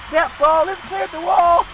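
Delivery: high imitation call; Michael Jackson calling it. Host voicing Michael Jackson calling a homer, high. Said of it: "He would say".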